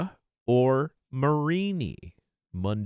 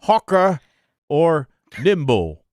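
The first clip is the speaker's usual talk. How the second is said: loud, higher-pitched attempt at an Australian accent; 3 semitones higher, 5 dB louder than usual. Shown as a demonstration. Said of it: "said both of these in an Australian accent".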